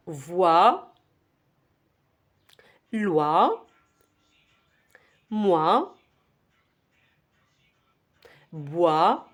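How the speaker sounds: syllables far apart, long pauses between; one rising syllable, then wait for the class; unhurried, isolated syllables for repeating; slowed-down drill. Shown as a demonstration. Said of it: "Je vais ralentir un petit peu".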